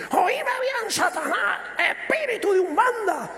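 imitating a deliverance preacher rebuking Satan; hammed-up exorcism cry; mock fiery preacher. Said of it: "¿por qué este hombre habla así?".

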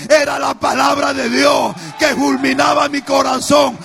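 loud, high fiery preacher; shouted fiery sermon; full-force preaching. Acted. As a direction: thunder it at the congregation.

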